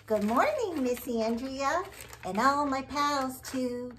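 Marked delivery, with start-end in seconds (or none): none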